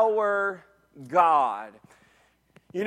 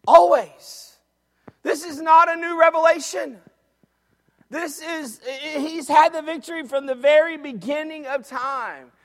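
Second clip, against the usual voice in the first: high, loud, fired-up preaching; preaching at full force; 9 semitones higher, 8 dB louder than usual. Acted. Preacher urging the church to shout in heaven, fired up. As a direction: lift the whole room with it.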